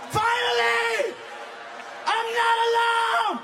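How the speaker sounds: high, slow triumphant shout; shouted relief. Real, not acted. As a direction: cry out in relief. Crowd relief, shouted joke.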